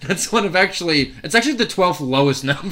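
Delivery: loud, high, laughing as he explains; laughing mockery. Real, not acted. Comic mocks friend's grasp of numbers, laughing and high. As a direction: crack up while mock-explaining, voice climbing. Cracking up at own joke.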